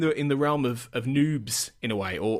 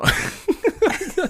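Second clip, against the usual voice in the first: high laugh; 5 dB louder than usual. Real, not acted. Laughing at own impression.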